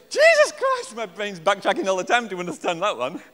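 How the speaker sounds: high, loud comic outburst; overwhelmed, played for laughs; mock exasperation. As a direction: explode in mock despair. Acting out an overworked listening brain.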